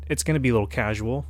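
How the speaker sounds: usual speaking voice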